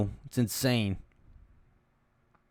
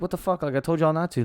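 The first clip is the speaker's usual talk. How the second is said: higher, indignant retold outburst; mock outrage; 6 semitones higher, 3 dB louder, faster than usual. Wife quoted cursing at the tire shop, mock outrage. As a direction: play her outrage at the charge. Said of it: "She's like".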